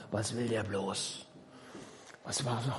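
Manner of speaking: low, soft aside in a servant's role; muttered wondering. Acted. Servants called in, wondering about the master, low mutter. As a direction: mutter it low, as if among colleagues.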